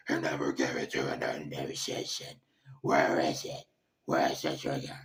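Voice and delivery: deep, hammed-up raspy growl; Batman. Host ending his best Batman impression, low growling interrogation. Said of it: "do my best Batman impression".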